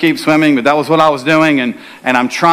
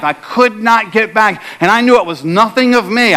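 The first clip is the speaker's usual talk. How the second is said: ordinary talk, nothing marked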